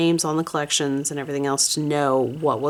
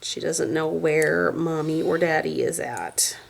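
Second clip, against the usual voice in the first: ordinary delivery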